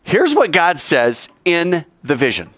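ordinary delivery

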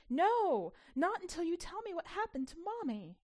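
loud, high protest; a child's furious outburst; near scream. Acted. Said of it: "Annalee wanted to scream".

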